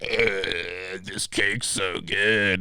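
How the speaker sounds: slow, drawn-out mocking groan; dragged-out put-on greedy voice; mocked gluttonous customer. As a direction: imitate a glutton savoring food. Mimics man stuffing in cake.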